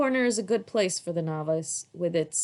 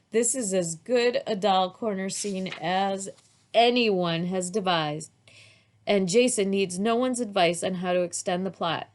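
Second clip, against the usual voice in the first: ordinary delivery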